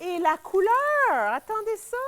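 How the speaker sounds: slow, drawn-out delight; amazed. Real, not acted. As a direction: marvel at the color. Admiring bright green kale.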